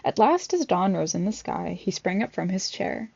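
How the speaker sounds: ordinary delivery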